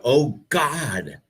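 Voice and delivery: hint at the ecstatic cry without really screaming; a light imitation of someone crying out in bed; mock orgasm cry. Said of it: "How many people scream out".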